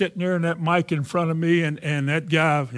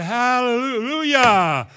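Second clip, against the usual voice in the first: very slow, higher, drawn-out hallelujah; stretched hallelujah; 8 semitones higher, 4 dB louder, much slower than usual. Retold or imitated. Stuttering preacher on air dragging out a slow hallelujah. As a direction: pull each syllable long and slow. Finally speaking after stuttering.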